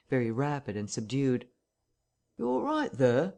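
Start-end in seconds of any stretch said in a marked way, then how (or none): 2.4-3.3 higher-pitched questioning call, rising at the end; Hall's inquiry. A narrator's reading. Hall calls to those inside the parlour, high and questioning. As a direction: call through the door, checking on them. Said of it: "asked Hall, rapping".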